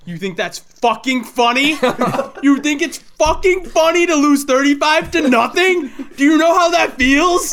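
coach yelling. Retold or imitated. Livid coach screaming at the laughing kid on the bus. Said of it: "he goes".

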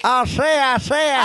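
put-on old Southern orator; Southern lawyer voice. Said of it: "the Southern lawyer voice".

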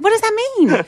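high, loud mock bewilderment; baffled question. Retold bewilderment at unknown word.

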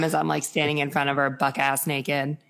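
normal talking voice

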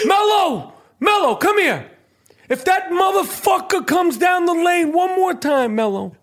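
Aiello coaching Carmelo from the bench; Danny Aiello impression. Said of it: "That was Danny Aiello".

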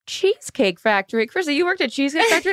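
high, fast, amazed question; an excited dad discovering a surprise; dad reading her bio, high-pitched and amazed; surprised dad. Retold or imitated. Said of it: "And all of a sudden he goes".

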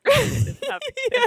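high laughing squeal. Real, not acted. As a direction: laugh through the words. Laughing at shared joke.

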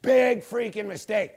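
normal talking voice

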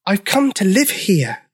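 high-pitched little dinosaur voice; a big creature speaking in a tiny high voice. A narrator's reading. Newcomer dinosaur to Brenda, tiny voice. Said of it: "in a voice so tiny".

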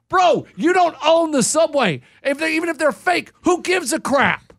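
high, drawn-out, exasperated mock rant; indignant retort. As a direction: tell the clerk off in outrage. Acted angry retort to the clerk.

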